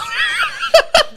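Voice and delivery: real high-pitched laugh; high burst of laughter. Real, not acted. Teller laughing at the lady's three thousand dollar deposit.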